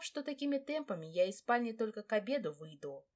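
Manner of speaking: ordinary delivery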